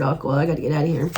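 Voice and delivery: ordinary talk, nothing marked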